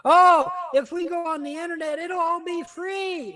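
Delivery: mocking naive optimism. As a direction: parody the internet's empty promise. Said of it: "Whatever happened to that shit?".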